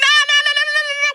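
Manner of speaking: high, loud string of no's; emphatic refusal. Retold or imitated. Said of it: "I'm like".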